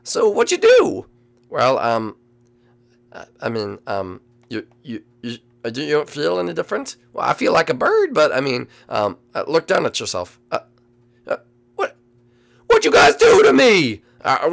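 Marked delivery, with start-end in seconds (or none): 0.0-1.0 squeaky bird. Acted. Bird asks what was done. 11.8-14.0 much higher and louder, alarmed; shocked squeaky bird. Acted. Bird shocked at its changed body, squeaky and loud.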